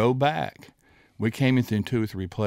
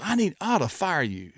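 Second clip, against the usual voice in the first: mock angry coach; 9 semitones higher than usual. Coach Bryant scolding, retold.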